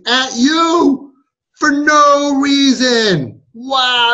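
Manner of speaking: slow, building incredulous shout; hammed-up indignation on his behalf; mock outrage. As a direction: draw it out, rising to a loud cry.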